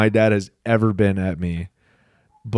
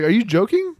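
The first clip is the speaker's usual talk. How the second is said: high-pitched, disbelieving question; mock disbelief, pitched up; incredulous dad; 9 semitones higher than usual. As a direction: imitate a dad's baffled question. Repeats dad's disbelieving question.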